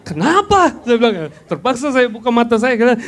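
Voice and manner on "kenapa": sharp, high startled Kenapa; a jolt of alarm, voice jumping up; startled what. Retold or imitated. Wife jolted awake at night, sharp alarmed exclaim.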